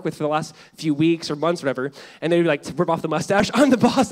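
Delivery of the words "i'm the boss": triumphant reveal. Retold or imitated. Boss reveals himself on show.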